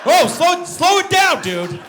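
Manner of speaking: very high, loud yell; mock alarm. Passenger to speeding motorcycle driver, alarmed. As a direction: shout it in hammed-up panic.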